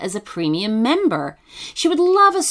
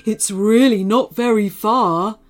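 sloth voice; 3 dB louder than usual. Acted. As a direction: coax gently like a sloth. Sloth inviting Matilda.

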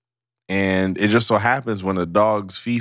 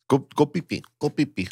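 put-on light cooing baby talk; baby talk to dog; 5 semitones higher, 3 dB quieter than usual. Cooing dog potty command.

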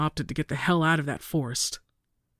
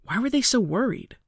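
plain reading voice

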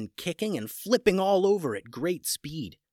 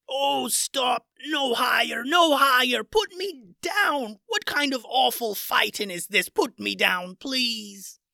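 terrified begging; 11 semitones higher, slower than usual. Acted. The pirate captain, lifted high by Owly, begs in terror. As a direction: beg in terror to be put down. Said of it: "so scared he dropped his sword".

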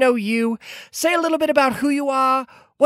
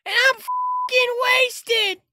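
campy drag queen; 12 semitones higher, much slower than usual. Acted. Hannigan's drunken boast.

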